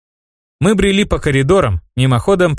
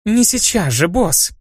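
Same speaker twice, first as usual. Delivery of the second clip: playful whisper; 7 semitones higher, slower than usual. A narrator's reading. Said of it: "игриво прошептала она".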